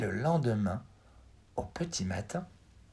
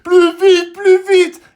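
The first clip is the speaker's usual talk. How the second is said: desperate cry; 19 dB louder, slower than usual. A narrator's reading. Fleeing the lion, crying out.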